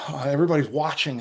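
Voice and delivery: ordinary delivery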